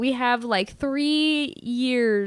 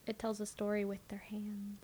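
whispering; 14 dB quieter than usual. Real, not acted. Whispered aside during movie talk.

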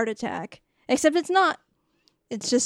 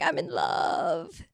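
lovesick sigh; 6 semitones lower, 4 dB quieter, slower than usual. Retold or imitated. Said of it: "she's like".

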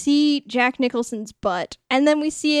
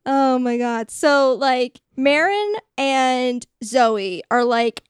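ordinary delivery

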